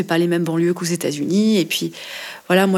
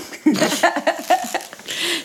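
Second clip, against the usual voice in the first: real high giggle; laugh; 7 semitones higher than usual. Real, not acted. Singer amused by her own remark on Spanish, giggling.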